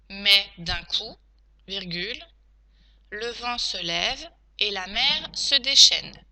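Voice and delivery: slow teacher dictating, punctuation named; careful, spaced-out phrases; dictation. Shown as a demonstration. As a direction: dictate it chunk by chunk.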